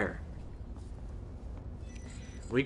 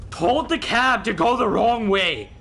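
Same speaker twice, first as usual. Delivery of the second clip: louder, higher and drawn out in outrage; exasperated outburst; 7 semitones higher, 8 dB louder, slower than usual. Real, not acted. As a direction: stretch it out, loud with disbelief. Angry retelling of wrong directions.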